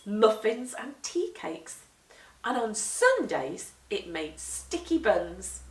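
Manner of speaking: usual speaking voice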